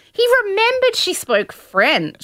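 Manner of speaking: high, drawn-out incredulous insistence; exasperated disbelief. Real, not acted. Podcaster defending a man accused unfairly, exasperated disbelief.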